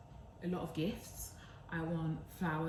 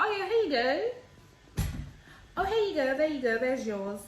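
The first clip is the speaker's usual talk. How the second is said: breezy handover; 7 semitones higher, 7 dB louder than usual. Retold or imitated. Handing over her cheap gift.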